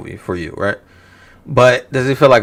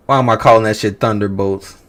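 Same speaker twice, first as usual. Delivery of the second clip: ordinary talk, nothing marked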